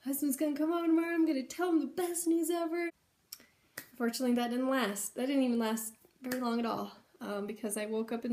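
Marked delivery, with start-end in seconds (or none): 0.0-2.9 high and rushing with joy; excited, fast, high chatter; giddy excitement. Retold or imitated. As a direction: gush like you're about to burst. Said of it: "and I was so excited".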